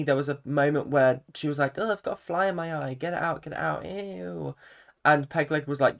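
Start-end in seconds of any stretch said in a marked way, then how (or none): 1.8-4.5 soft, quick mock whine; quietly whiny imitation, trailing into a small "ew"; mildly whiny. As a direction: lightly imitate someone whining and squeamish. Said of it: "she's just a little bit whiny".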